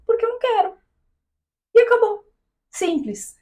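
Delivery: high, firm "porque eu não quero"; defiant. Retold or imitated. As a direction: shut it down, no arguing.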